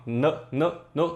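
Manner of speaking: "N" said as its consonant sound, nuh, in short separate bursts; isolated letter sound. Shown as a demonstration. Teacher showing students the sound of N, isolated consonant. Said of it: "O N tem letra assim".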